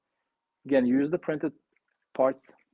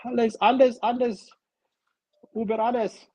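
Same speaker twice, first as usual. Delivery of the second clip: much higher, loud hammed-up German accent; mock German shouting; 10 semitones higher, 5 dB louder than usual. Voicing the German tank crew, mock German yelling. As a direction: yell like a cartoon German officer. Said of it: "Sorry, German fan".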